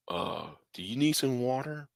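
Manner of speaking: very soft, tentative offer; imitated timid mother. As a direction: play the mother cautiously offering help, barely voiced.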